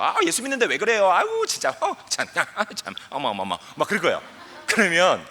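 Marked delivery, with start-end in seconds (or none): 0.0-3.6 high, exaggerated gasping tut-tutting; mock scolding outsiders. Preacher mimics people scolding a believer, mock shocked. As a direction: play the shocked, nagging critics. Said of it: "막 욕할 거예요".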